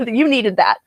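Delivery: chuckling through the words; a slow, amused laugh under the line. Real, not acted. Teasing a viewer after long reading.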